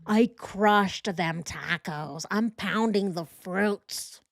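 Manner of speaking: slow, drawn-out mock gym bro; mock his bro talk, stretching each word; ponderous, annoying bro imitation; Chris. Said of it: "The way that Chris talks is so annoying".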